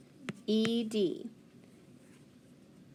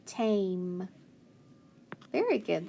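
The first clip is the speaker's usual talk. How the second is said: usual speaking voice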